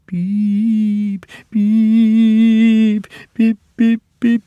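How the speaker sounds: high, drawn-out eerie beeps; ghostly shriek beeps. A narrator's reading. Percy pretending to haunt the shed with ghostly shrieks. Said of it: "he shrieked".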